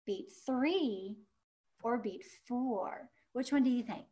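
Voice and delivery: usual speaking voice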